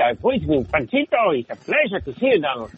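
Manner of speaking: lively Mexican-accented cartoon voice; Panchito. Shown as a demonstration. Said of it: "I was the voice of Panchito".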